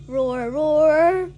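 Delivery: loud roaring; tiger roar. Retold or imitated. Tiger roar in children's animal chant, loud roar. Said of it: "Tiger goes roar".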